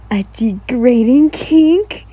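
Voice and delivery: slow, drawn-out dramatic gasp of a question. A narrator's reading. Reader gasping dramatically at her friend. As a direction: ham up the shocked question. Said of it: "You spoke out dramatically".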